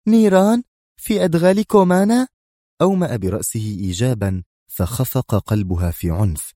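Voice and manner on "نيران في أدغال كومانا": much higher, louder amazed questions; dazzled exclamation. A narrator's reading. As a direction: cry out in astonishment. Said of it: "هتفت مبهورة".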